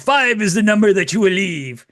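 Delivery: loud, high Count voice. Acted. Co-host joining in as the Count, loud and theatrical. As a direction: declare the number like the Count. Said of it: "the count from Sesame Street".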